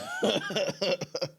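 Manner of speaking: high, strained laugh; pained laugh. Real, not acted. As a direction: laugh through the burn. Laughing after tearing up.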